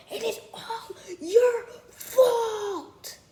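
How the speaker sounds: high, slow, whispered whine through laughter; mock his wail but crack up; giggling, breathy imitation of a crying kid; laughing while imitating. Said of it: "I'm just laughing because".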